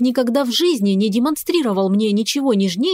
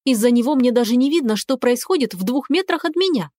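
ordinary delivery, nothing marked

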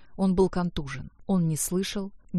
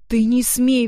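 louder, higher outburst; indignant, raised-voice retort; defiant exclamation; 5 semitones higher, 7 dB louder, slower than usual. A narrator's reading. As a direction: throw the warning back as a cry. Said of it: "воскликнула она".

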